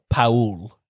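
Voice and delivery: "Paul" as "pa-OOL" in two beats; Paul like Raoul. Shown as a demonstration. Host naming her favourite swap, Paul like Raoul. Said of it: "instead of Paul".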